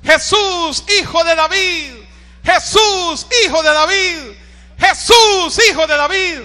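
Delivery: loud, high, drawn-out cry for help; shouted, repeated plea from a blind beggar; Bartimeo shouting. Acted. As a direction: call out to Jesus over a crowd, pleading to be heard. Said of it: "Bartimeo le gritó a Jesús".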